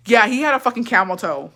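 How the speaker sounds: ordinary talk, nothing marked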